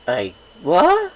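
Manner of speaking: loud, rising startled I what; double take. A narrator's reading. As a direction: caught completely off guard. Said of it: "I did a double take".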